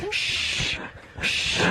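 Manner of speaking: drawn-out "shh" of a moviegoer; shushing. Acted. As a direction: hush the people around you, all breath. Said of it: "and you're just going".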